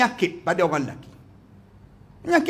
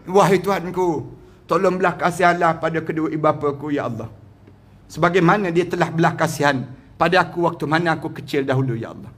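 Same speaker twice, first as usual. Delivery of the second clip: lower, humble pleading prayer; humble prayer for parents; 5 semitones lower than usual. Acted. Models a prayer.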